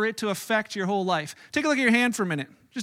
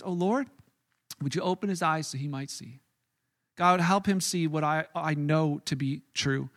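soft prayer; 6 semitones lower, 6 dB quieter than usual. Retold or imitated. Elisha prays quietly for his servant, soft low prayer. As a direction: pray gently for his eyes to open. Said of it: "he just simply prays".

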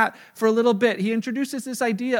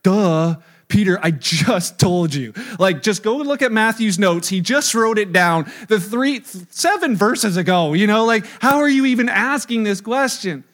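loud, incredulous scolding; mock exasperation; 6 dB louder than usual. Exasperated reply voiced, "that'd be me.

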